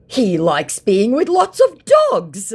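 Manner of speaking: high, very slow put-on voice; mocking the scammer's lame excuse. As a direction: drag it out like a dim scammer. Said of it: "he said".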